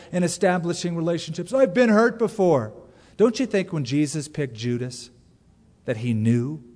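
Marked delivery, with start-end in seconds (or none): 1.5-2.7 higher, louder defensive excuse; hurt excuse. Pretend. Preacher voicing people who refuse closeness, higher and defensive. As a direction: plead the old wound. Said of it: "keeps a lot of people from".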